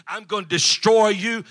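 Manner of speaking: loud menacing threat, voice rising on destroy; retold threat. Rival businessman threatening the pastor, loud menacing threat. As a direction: hurl the threat at him.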